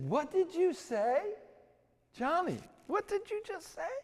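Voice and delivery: high, astonished question; stunned disbelief. Retold or imitated. The campus beauty can't believe what Johnny asked. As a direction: ask in shock, voice flying up.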